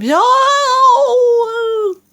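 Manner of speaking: long, loud, very high howl; high wail held long; roar. A narrator's reading. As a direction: let out a giant's howling roar. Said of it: "roared the flesh lump".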